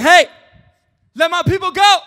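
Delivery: high, loud mock demand; calling out. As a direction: call it out like a command to a ruler. Acting Moses confronting Pharaoh.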